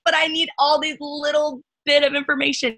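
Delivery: a nervous beginner wanting every detail; lightly voiced worried newcomer; mock anxious newbie. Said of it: "you're like".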